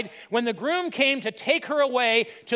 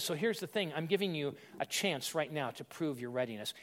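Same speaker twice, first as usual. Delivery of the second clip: hushed Jesus voice; 7 semitones lower, 10 dB quieter than usual. Retold or imitated. Jesus voiced, softer and lower.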